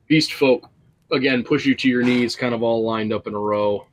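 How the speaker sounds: ordinary talk, nothing marked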